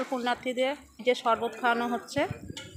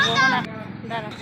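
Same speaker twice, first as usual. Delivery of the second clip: calling out; 3 semitones lower than usual. Real, not acted. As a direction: shout his name to get attention. Calling a boy at temple. (ডেকে ওঠা)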